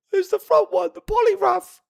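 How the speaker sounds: crying little sister. Retold or imitated. Crying sister tells mum.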